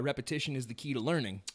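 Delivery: usual speaking voice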